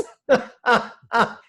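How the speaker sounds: real hearty laugh; loud laugh. Real, not acted. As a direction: burst of high loud laughter. Joke about cats and chanting.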